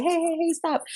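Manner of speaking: scrambling to break up a fight; frantic hey stop. Retold or imitated. Someone pulling fighters apart, calling out high and frantic. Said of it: "You gotta be like".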